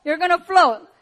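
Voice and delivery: high, loud urgent voice calling out. Retold or imitated. An inner voice telling her in the river, high urgent call. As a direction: call the instruction out from above. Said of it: "that voice came to me".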